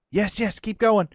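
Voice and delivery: high, loud cheering on; will it to keep walking, thrilled; excited urging. Real, not acted. Gamer cheering the predator away from his raptor, high and loud.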